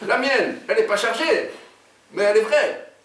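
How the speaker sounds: high-pitched defensive protest; indignant sidekick. Acted. Oumar defends his own unloaded gun, indignant. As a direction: insist in a high character voice.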